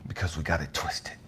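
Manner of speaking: low unvoiced whisper on Because we got it twisted; soft low whispered line. Shown as a demonstration. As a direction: hush it down to a whisper.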